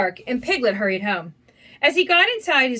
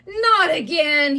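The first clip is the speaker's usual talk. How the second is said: slow, drawn-out, higher cry; a touch of storybook wail; exasperated cry; 4 semitones higher, much slower than usual. A narrator's reading. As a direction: stretch it out in mild dismay. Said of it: "he cried".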